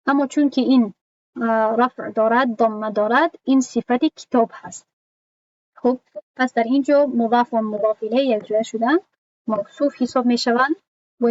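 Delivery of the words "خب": ordinary talk, nothing marked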